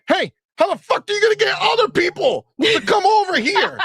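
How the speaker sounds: high, loud, laughing mock yell; hammed-up, laughing shrill-voiced rant; mocking fan voice. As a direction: squeak it out like an outraged fan. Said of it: "And then you're like".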